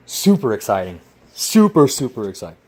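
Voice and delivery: 反讽式夸张兴奋; 第一个super突然拔高喊出，随后泄气; mock excitement. Sarcastic reply about the build.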